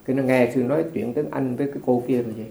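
normal talking voice

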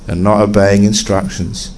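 normal talking voice

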